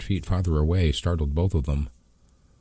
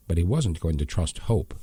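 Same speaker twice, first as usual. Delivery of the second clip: plain reading voice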